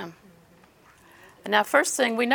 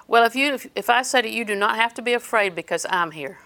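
ordinary delivery

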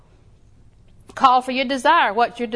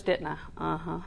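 murmured, sheepish confession and reply; hushed back-and-forth with God; quiet sheepish exchange; 4 semitones lower, 10 dB quieter than usual. Retold or imitated. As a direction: drop almost to a murmur, guilty. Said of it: "I said".

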